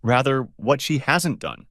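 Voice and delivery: measured, hesitant delivery; slow and deliberate. Acted. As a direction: take it slowly, choosing words. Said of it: "Anne began slowly".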